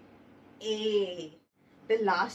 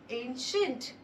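ordinary talk, nothing marked

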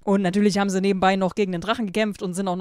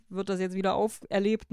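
ordinary delivery

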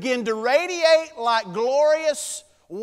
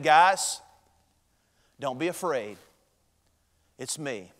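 softer, gently comforting; gentle reassurance; 8 semitones lower, 3 dB quieter than usual. Retold or imitated. Jesus softly reassuring his fallen disciples, gently. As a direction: ease their fear, quietly and kindly.

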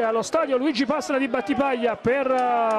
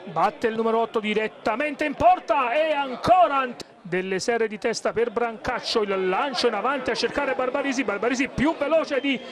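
usual speaking voice

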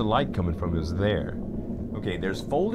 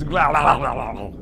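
monster noises; 5 dB louder than usual. Retold or imitated. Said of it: "That's what things that eat things sound like".

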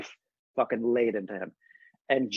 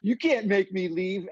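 imitating a defiant kid, higher and slower; defiant twelve-year-old; 6 semitones higher, slower than usual. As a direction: dig in like a stubborn son, pitched up. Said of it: "the twelve-year-old son will be like".

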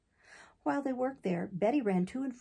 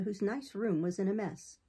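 plain reading voice

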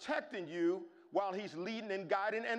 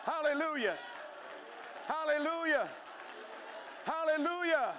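shouted hallelujah; 8 semitones higher than usual. Real, not acted. Congregation praise, shouted.

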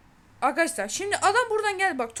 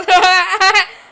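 laugh; 3 semitones higher, 12 dB louder than usual. Real, not acted. Laughing at six nil.

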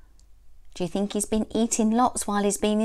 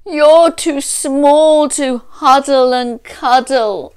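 bawling bear; 3 semitones higher, 10 dB louder than usual. A narrator's reading. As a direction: bawl it out across the whole wood. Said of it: "hear the voice bawl".

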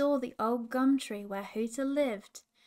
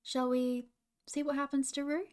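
plain reading voice

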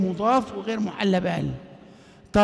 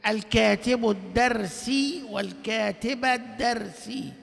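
slow recitation; 6 dB quieter, slower than usual. Shown as a demonstration. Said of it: "recites example".

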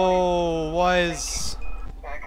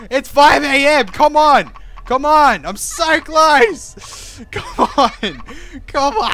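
louder, higher urgent pleading; tense urging; 5 semitones higher, 7 dB louder, slower than usual. Real, not acted. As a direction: urge it on desperately. Almost winning night.

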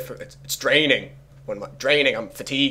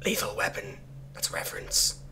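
ordinary talk, nothing marked

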